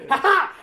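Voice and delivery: high loud gloating ha ha; villain laugh. Retold or imitated. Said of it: "spoke with a bad French accent".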